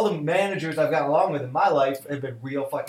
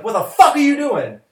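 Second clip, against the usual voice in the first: much higher, louder mock yell at the worker; angry yell; 10 semitones higher, 7 dB louder than usual. Landlord confronting the busy worker, loud and angry. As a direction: bark it like a furious boss. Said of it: "and he comes up".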